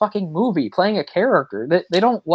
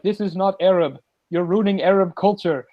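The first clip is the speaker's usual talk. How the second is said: mock Arabic accent, pitched up; a hint of put-on Arabic accent; fake Arabic accent; 4 semitones higher than usual. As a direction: lightly imitate a bad Arabic accent. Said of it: "she starts doing a fake Arabic accent".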